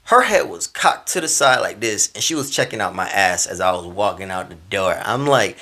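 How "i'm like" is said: usual speaking voice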